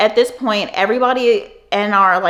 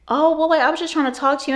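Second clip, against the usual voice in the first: higher, airy innocent tone; lightly mocking a guy playing innocent; fake-innocent Chris; 7 semitones higher, faster than usual. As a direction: act hurt and innocent.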